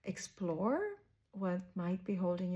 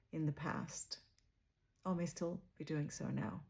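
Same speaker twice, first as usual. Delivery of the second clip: softer, unhurried meditation voice with long pauses; guided meditation; 4 dB quieter than usual. Real, not acted. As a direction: guide listeners gently inward, leaving space after each phrase.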